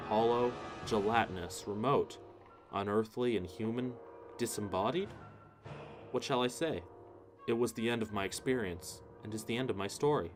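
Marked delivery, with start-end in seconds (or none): none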